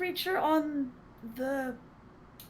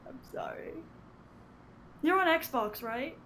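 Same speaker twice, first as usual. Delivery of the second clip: ordinary talk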